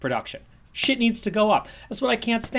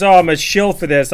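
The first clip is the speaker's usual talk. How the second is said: mock accusation; 7 dB louder than usual. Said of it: "no one thinks".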